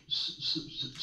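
ordinary delivery

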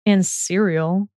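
plain reading voice

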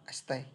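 unstressed "I" run into "stay"; stress on STAY. Shown as a demonstration. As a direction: skim "I", no pause before "stay". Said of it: "Ai không có trọng âm bắt vào stay".

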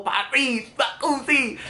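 high, hammed-up flourish; playful showing off. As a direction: toss the names out high and showy. Riffs showily on own flashy persona.

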